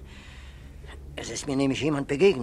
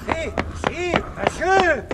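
high, loud call across a square; 13 semitones higher, 4 dB louder, slower than usual. Acted. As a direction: call out to a stranger. Policeman calls out at night.

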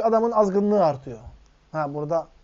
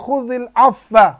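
Quranic recitation; 5 semitones higher, 12 dB louder, much slower than usual. Acted.

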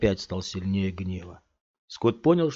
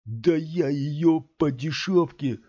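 slow, higher, louder lewd brag; leering thug; 6 semitones higher, 4 dB louder, slower than usual. A narrator's reading. Third thug boasts crudely to his friends, leering. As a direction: leer as you say it.

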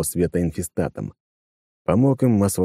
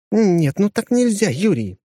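slow, higher exasperated woman; exasperated young woman; 13 semitones higher, slower than usual. A narrator's reading. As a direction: plead it, worn out. Exasperated female character.

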